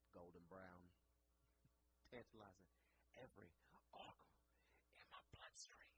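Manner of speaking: soft, breathy, savoring each word; sensual hush. Retold or imitated. Guest praising the rum in a soft, breathy hush. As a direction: whisper it like a love poem. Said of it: "I'm getting a little emotional".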